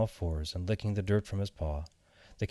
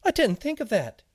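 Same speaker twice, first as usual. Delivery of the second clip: very high, loud tiny seed voice; shuddering seed; 6 dB louder than usual. A narrator's reading. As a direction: pipe up high as a startled little seed. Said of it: "the seed shuddered".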